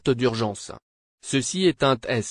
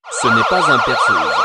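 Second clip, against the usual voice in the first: much higher, louder flat monotone alert; robotic siren alert; 6 dB louder than usual. Acted. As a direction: drone it out like an alarm siren. Repeated emergency alert. (voix d'alerte robotique, aiguë et forte)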